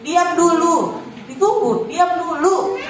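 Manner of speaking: lightly imitating a patient, unbending mum; mother standing firm. Mother ignoring the tantrum, gently insisting, mildly firm. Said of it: "mama saya nggak peduli".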